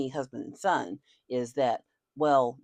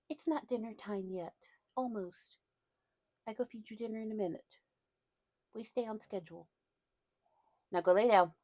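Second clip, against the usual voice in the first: higher, softer coaxing pet voice; 6 semitones higher, 4 dB quieter than usual. Real, not acted. Owner telling dog dinner is soon, soft baby talk. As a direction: coax the dog gently.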